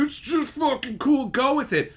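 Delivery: mocking. Mocks the dismissive excuse.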